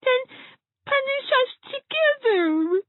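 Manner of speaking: put-on high, slow, near-crying child; little girl about to cry; tearful plea. A narrator's reading. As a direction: plead as tears begin. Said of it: "beginning to cry".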